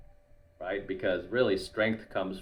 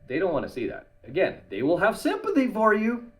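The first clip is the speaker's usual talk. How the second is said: normal talking voice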